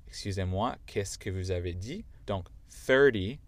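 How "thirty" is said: loud American thirty, like thurdee; American thirty, louder, stress up front; American accent. Shown as a demonstration. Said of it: "avec mon accent".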